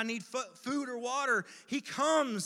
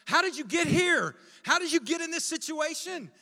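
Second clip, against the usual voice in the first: high, loud interrogation; scolding; 7 semitones higher, 7 dB louder than usual. Retold or imitated. Imagined frustrated shepherd questioning the sheep, scolding. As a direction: demand answers as if exasperated.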